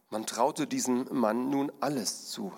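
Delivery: normal talking voice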